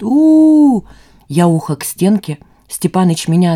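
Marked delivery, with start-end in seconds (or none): 0.0-0.8 long, high, loud train hoot; imitated locomotive whistle, "oo" held high. A narrator's reading. Enraged son imitating a departing train, loud hoot. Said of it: "изображая поезд".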